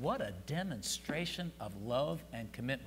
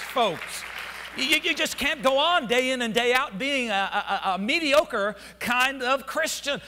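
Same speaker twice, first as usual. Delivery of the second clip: high and loud, driving every line; preaching at full force; 12 semitones higher, 11 dB louder than usual. Acted. Preacher calling the church to passion, high and loud. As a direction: shout the passion into them.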